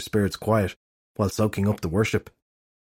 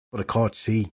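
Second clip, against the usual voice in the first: ordinary delivery, nothing marked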